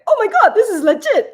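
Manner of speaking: high, loud, imitated burst of delight; amazed reaction. Cook mimicking her boyfriend's first taste, excited. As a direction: act out an excited taste-tester.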